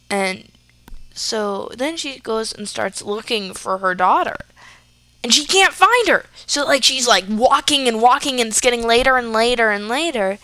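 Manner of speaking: plain reading voice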